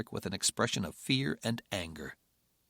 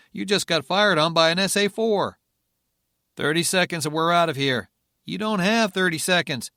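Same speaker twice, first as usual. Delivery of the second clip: urgent shout; 6 semitones higher, 7 dB louder than usual. Acted. Urgent radio exchange.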